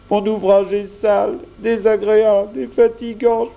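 put-on boy feeling sorry for himself, growing whinier; self-pitying lament. A narrator's reading. Jeannot laments his lot. (Jeannot plaintif, de plus en plus geignard)

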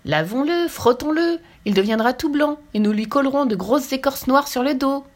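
lordly lion declaring his plan; grand lion. A narrator's reading. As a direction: announce the plan like a king. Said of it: "lança magistralement le lion".

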